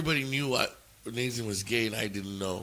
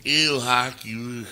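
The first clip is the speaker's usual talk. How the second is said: mock flamboyant disgust, a bit louder; hammed-up flamboyant "ew"; over-flamboyant caricature; 4 dB louder, slower than usual. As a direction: imitate a squeamish, flamboyant recoil. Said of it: "the over flamboyant".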